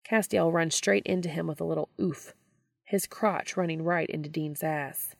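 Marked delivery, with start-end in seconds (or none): none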